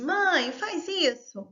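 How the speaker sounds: put-on high, loud pleading child tugging at mum; child nagging mother, high and insistent; whiny child. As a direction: pester mum over and over like a small child. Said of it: "puxando a saia da mãe e falando assim".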